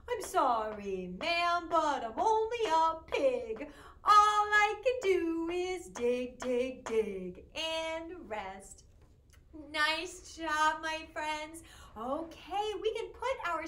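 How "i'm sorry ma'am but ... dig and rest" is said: slow, mock sorry pig, louder on dig; a pig shrugging off the blame; apologetic pig. Pig answering the farmer in the rhyme, mock apologetic. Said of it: "when I was talking like the pig".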